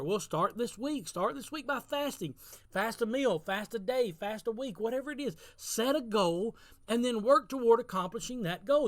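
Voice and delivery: rally the congregation to get moving; preaching at full force. Acted. Exhorting congregation to set goals.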